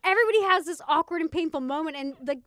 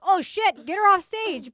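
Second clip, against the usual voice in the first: raised, louder mock panic; mock alarm; 5 semitones higher, 8 dB louder, slower than usual. Co-host reacting to a stage mishap, mock alarm. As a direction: call it out urgently, playacting.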